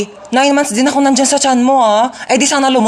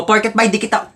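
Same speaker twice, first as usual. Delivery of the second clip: usual speaking voice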